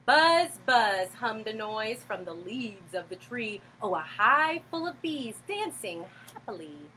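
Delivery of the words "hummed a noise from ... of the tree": plain reading voice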